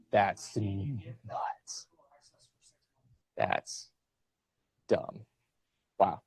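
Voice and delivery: breathy whisper under the breath; whispered aside. Real, not acted. Professor whispering to himself about textbook prices, hushed aside. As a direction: mutter it to yourself, barely voiced.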